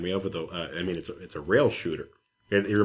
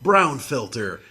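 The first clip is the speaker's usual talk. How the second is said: higher, louder mock announcement, slowing down; mock game pitch; 7 semitones higher, 5 dB louder, much slower than usual. Mocking the game's brown look, lightly mock announcement. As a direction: announce it like a proud ad. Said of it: "it's just like".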